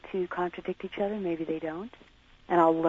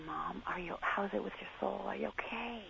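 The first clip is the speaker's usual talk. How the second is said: softly voiced, tentative check-in; gentle concern; 2 semitones higher, 12 dB quieter than usual. Daughter imagining asking her dying mom, soft and tender. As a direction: ask it very softly, with care.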